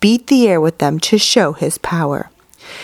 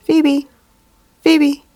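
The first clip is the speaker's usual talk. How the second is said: "Phoebe" pitched high and falling, like a birdcall; phoebe's call; 7 semitones higher than usual. A narrator's reading. Narrator voicing the phoebe on the apple bough, high falling call. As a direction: chirp "Phoebe" high, dropping each time.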